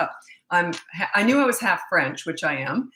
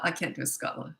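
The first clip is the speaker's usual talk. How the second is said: normal talking voice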